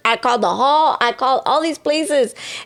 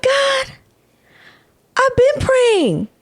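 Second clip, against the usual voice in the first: pleading; 14 semitones higher, slower than usual. Retold or imitated. Pleading to God, high.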